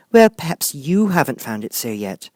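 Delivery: high, lightly voiced girl; a young girl, hesitant; Alice. A narrator's reading. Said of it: "said Alice".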